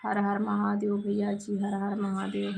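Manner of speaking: usual speaking voice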